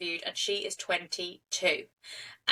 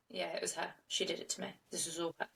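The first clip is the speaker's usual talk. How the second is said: almost whispered, much softer; hushed confession; 3 semitones lower, 12 dB quieter, faster than usual. Retold or imitated. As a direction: confide it under your breath, barely voiced. Alex confides to officer, quietly.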